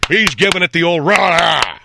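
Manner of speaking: high, loud, drawn-out crowing; slow, loud mock hurrah; loud cheering. Describing the cheering moviegoer, mock cheering.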